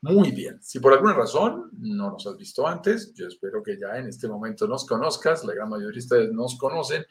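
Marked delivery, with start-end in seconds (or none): none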